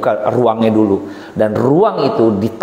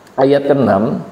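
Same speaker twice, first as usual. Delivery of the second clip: ordinary talk, nothing marked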